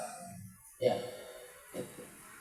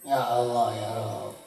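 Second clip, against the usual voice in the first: normal talking voice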